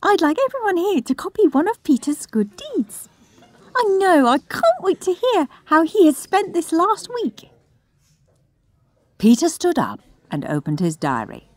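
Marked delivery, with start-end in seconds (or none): 0.0-7.5 high, bright-eyed teacher addressing the hall; chirpy teacher. Acted. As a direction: gush over her favourite pupil.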